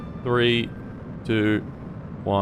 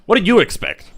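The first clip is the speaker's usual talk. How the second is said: high, loud incredulous echo; indignant outburst; 10 semitones higher, 6 dB louder than usual. Real, not acted. Streamer repeating chat's question, indignant outburst. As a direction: throw the question back, pitched up and louder.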